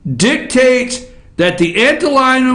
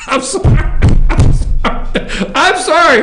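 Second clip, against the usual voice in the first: real giggling through "I'm sorry"; sorry, can't stop laughing; laughing apology; 3 semitones higher than usual. Real, not acted. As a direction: apologize while still laughing. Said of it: "I shouldn't laugh".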